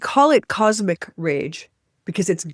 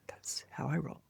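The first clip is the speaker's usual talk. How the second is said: a soft unvoiced whisper; whispered aside; 3 semitones lower, 16 dB quieter than usual. Real, not acted. Whispers a joke after apologizing to listeners for swearing. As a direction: tuck it away as a whispered joke.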